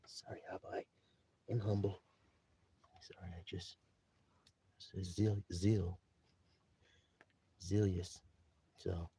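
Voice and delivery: very low, near-whispered mumble; humble apology. Acted. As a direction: apologize sheepishly, barely audible, with halting pauses. Grandson apologizing humbly to Abba.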